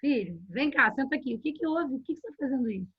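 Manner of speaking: soft, acted gentle questioning; calm parent. Parent invites child to sit and explain, calm and soft. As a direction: coax the child over, softly. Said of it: "Antes de sair dando a bronca".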